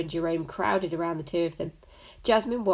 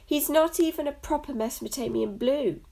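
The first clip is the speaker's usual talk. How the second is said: high, snooty female cat voice; high catty voice; 8 semitones higher than usual. Acted. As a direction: sniff it with snobbish disdain. Jasmine's catty aside.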